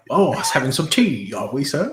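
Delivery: mild British butler inquiry. Acted. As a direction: play a fussy English valet. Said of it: "Or, like".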